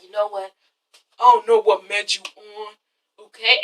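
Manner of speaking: low, softened imitation of calm talk; calm confrontation. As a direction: say it low and unhurried, mimicking him. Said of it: "he's just talking calm".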